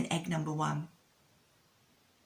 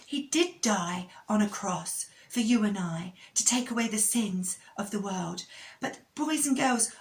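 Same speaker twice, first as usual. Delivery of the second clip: put-on upbeat, higher children's presenter; kids show host; 5 semitones higher than usual. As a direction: cheer the children on.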